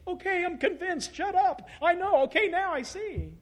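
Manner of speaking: high-pitched, fed-up giving in; mock exasperated surrender. Listener badgered all day finally gives in, mock exasperated. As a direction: play someone worn down and begging off.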